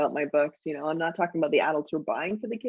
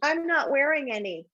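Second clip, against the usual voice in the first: put-on higher, matter-of-fact kid voice; the kid answering his mom without missing a beat, higher; deadpan kid; 6 semitones higher than usual. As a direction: say it flat and casual like a little kid. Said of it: "the kid goes".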